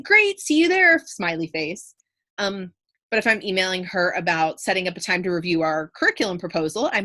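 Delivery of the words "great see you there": high, louder cheery sign-off; casual, upbeat. Shown as a demonstration. Teacher voicing a casual email to the principal about drama tickets, upbeat. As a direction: chirp it like a quick friendly note. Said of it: "I might be like".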